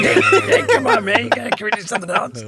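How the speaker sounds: laughing mock whine, fast at the end; laughing impression of the rapper. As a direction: squeal it through laughter, pleading.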